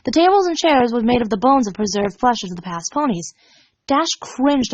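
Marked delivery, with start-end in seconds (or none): none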